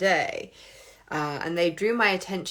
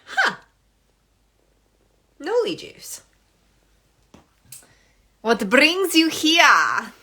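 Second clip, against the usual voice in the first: curious viewer voice; 7 semitones higher, 5 dB louder, slower than usual. Retold or imitated. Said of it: "you're like".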